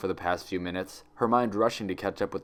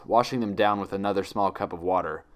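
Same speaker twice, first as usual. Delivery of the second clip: ordinary delivery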